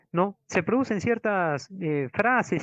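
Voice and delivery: ordinary delivery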